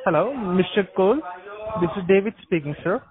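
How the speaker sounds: usual speaking voice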